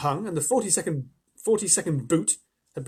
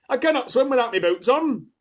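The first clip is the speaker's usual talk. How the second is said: higher, louder muddled centipede; flustered centipede; 8 semitones higher, 9 dB louder than usual. A narrator's reading. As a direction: blurt it then correct himself. Centipede panicking.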